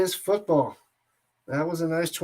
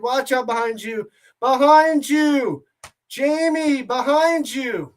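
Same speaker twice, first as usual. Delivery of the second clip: half-hearted warning yell, rising on "Behind you"; replaying the yell, slow on the last "behind you"; mock scream retold; 5 semitones higher, 4 dB louder, slower than usual. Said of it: "I went all scream style".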